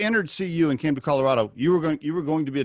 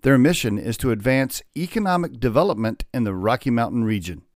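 louder, polished podcast ad read; sponsor ad read; 5 dB louder than usual. Acted. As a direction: read the sponsor spot like an announcer.

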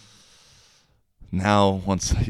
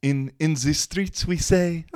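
high, hammed-up French accent; mock French-girl voice, th turned to z; 9 semitones higher, 4 dB louder than usual. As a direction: imitate a French girl, pitched way up. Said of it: "as the French girl said".

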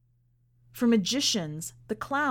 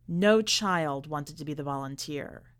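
ordinary delivery, nothing marked

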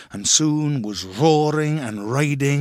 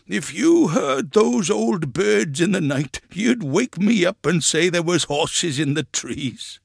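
raised, slow carrying call; calling across a field; 5 semitones higher, 3 dB louder than usual. A narrator's reading. Grandpa to grandchild among the pigeons, calling out. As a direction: send it over a distance, higher pitched. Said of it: "like a man calling across a field".

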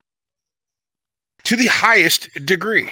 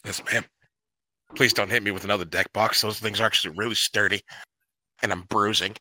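low, soft, fast and timid; meek and cowed; 7 semitones lower, 7 dB quieter, faster than usual. Retold or imitated. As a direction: mumble it like a beaten sidekick. Cowed reply after being told off.

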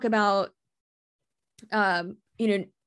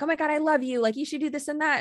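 lightly voiced gushing fan, higher; a hint of a starstruck fan; 7 semitones higher than usual. Quoting a fan's livestream message in a gushing voice. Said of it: "had said on there".

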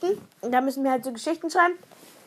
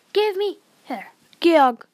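higher, loud call; loud demand; 6 semitones higher, 11 dB louder, slower than usual. Real, not acted. Sister demanding her brother return the toy, loud call. Grabbing the cuddly toy back.

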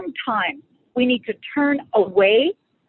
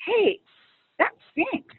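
high mock exclamation, then a soft drop; frank acknowledgement; 9 semitones higher, 4 dB quieter, slower than usual. Acknowledging to her spouse that it hurt, frankly.

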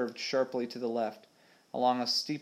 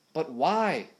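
mild narrated shout, higher pitched; raised, protesting question; 5 semitones higher than usual. A narrator's reading. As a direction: call it out without full volume. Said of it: "shouted Tubby Ted".

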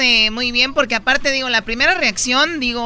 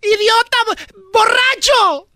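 higher, louder, furious yelling; angry shout; 10 semitones higher, 4 dB louder, slower than usual. Acted. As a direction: scream the insult at him. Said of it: "Y dijo la muchacha".

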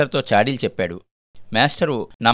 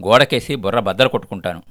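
plain reading voice

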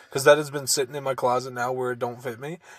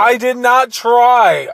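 loud, high, drawn-out denial; real defensive outburst, pitched up; indignant protest; 10 semitones higher, 8 dB louder, much slower than usual. Real, not acted. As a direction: protest your innocence, stretching the words out. Protesting an accusation of body shaming.

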